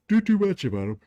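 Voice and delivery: words stretched by a real yawn. Real, not acted. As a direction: talk through a late-night yawn. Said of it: "Excuse me".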